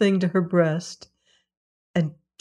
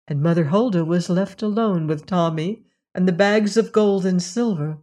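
usual speaking voice

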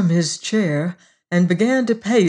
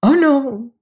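louder, high-pitched hurried protest; hasty denial; 9 semitones higher, 5 dB louder than usual. A narrator's reading. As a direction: jump in to deny it. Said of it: "the devil said hastily".

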